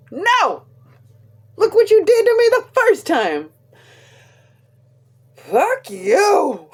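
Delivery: high, loud hammed-up protest; mock outrage. Daughter refusing Dad's pick after the first one, mock outrage. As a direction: throw an indignant fit, playfully overdone.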